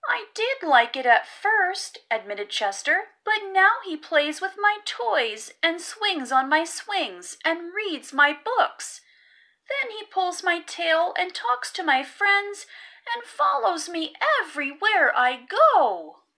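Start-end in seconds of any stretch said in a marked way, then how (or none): 0.1-2.0 put-on high, loud child's voice; small boy complaining to mom; little raccoon voice. 3.2-16.2 put-on high, loud child's complaint; little raccoon voice. Chester complaining about brother.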